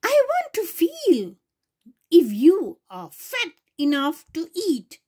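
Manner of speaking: high, sly witch voice. Acted. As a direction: reach up high for the wicked witch. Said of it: "she said".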